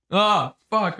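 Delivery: laughing mid-word, high and loud; laughing curse. Real, not acted. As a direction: laugh it out, caught off guard. Milk on chin, laughing.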